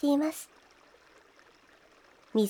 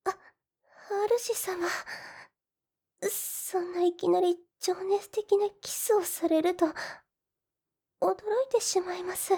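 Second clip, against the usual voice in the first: higher, breathless startled; flustered surprise; 5 semitones higher than usual. Acted. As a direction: caught off guard by the kiss. Heroine startled by passionate kiss. (驚いて上ずった声)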